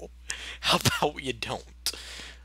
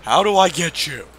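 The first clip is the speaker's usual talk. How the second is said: raised voice, repeating the question; louder, trying to get through; calling out louder; 3 semitones higher, 9 dB louder than usual. Real, not acted.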